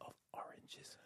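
unvoiced whispered prompt. Real, not acted. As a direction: whisper the line to help him.

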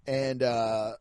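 normal talking voice

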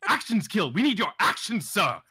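high, emphatic hammed-up insistence; forceful mock urging. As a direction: cut in and command him theatrically, punching each phrase. Acted overriding of guard's refusal.

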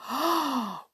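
sharp acted intake of breath; shocked gasp. A narrator's reading. Princess hears her friends insulted, shocked gasp. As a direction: gasp as if offended.

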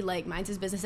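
usual speaking voice

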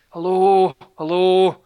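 retold loud, high hello calls; mock-loud hello called across a room; calling out. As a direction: call out to a stranger who won't turn round. Hector calling out to Katie.

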